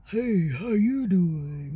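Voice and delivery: slow, strange, drawn-out greeting; his other voice. Retold or imitated. Boyfriend's odd different voice, slow and strange. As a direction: stretch the hello out like someone else. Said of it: "he was sounding like".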